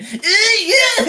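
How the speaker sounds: very high, loud squealed recoil, laughing; mock disgusted reaction. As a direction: squeal it like a horrified girl, hammed up. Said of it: "The way she reacted".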